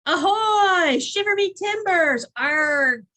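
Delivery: loud, high hammed-up pirate. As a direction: shout it like a pirate captain. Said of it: "sound like a convincing pirate".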